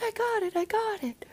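high, hushed excited whisper; quiet squeal of joy; whispered cheer. Retold or imitated. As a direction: cheer under your breath. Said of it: "Be a little quieter".

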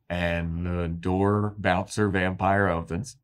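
ordinary talk

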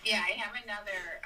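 ordinary talk, nothing marked

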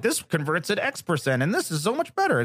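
high, mocking sales-pitch voice; mocking salesy pitch. To the host, mocking pop-up sales claims in a high voice. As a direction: parody a marketer bragging about conversions.

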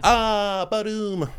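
high, drawn-out "ah, ba-doom"; mock punchline sting. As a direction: sing out a joking sound effect, high. Imitated sting after own jab.

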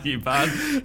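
ordinary delivery

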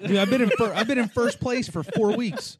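high, loud outraged protest; indignant protest. Real, not acted. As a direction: bristle and push back hard. Protesting loudly.

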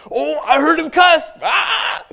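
imitated high, gleeful tattling shout; mocking. Mockers catching the new convert in a trap, mocking. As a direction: yell it out like a taunting accuser. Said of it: "they mock me, they set me up".

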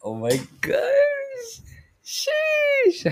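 very high squealing gasp; excited squeal. Real, not acted. Overwhelmed host squeals at her first live, very high. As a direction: squeal it like you can't contain yourself.

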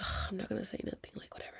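under-the-breath grumble, trailing off; soft, quick, dropping to almost nothing; holding back anger. Retold or imitated. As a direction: swallow the irritation quietly. Holding back anger in retold moment.

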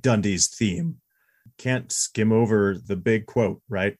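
ordinary talk

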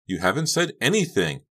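high, perky prodding; eager urging. A narrator's reading. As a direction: coax a reply out of them. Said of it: "Honey urged".

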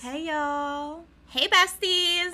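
high, drawn-out mock Southern greeting; put-on Southern accent. Host greets besties, mock Southern accent. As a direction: sing out a pretend Southern hello.

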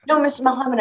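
high-pitched mock protest from a proud mom; mock insistent mother. Mother contradicting Muhammad Ali, imitated insistence.

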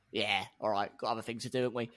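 low, soft Cockney grumble; Claire Williams, Cockney accent. Acted. Team chief backing down to Toto, low Cockney voice. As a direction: give in with offhand Cockney. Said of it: "react well to Cockney only".

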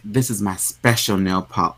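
lightly Southern, low and soft; Southern accent. Acted. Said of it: "I don't know why she's Southern right now".

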